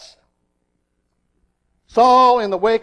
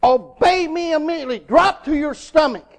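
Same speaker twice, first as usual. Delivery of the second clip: higher-pitched, urgent fatherly command; stern; 6 semitones higher than usual. Retold or imitated. Father urgently commanding his little son from the porch, stern. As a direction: order the child sternly, no room for argument. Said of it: "very sternly he said to him".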